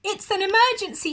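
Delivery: high, loud, fast cry; shouting. Acted. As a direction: make it sound urgent and alarmed. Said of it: "shouted Aussie".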